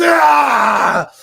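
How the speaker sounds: short loud mock cry of pain; mock sudden scream. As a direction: blurt a sudden pained "Ah!". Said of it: "she gets, like, a cramp from the baby".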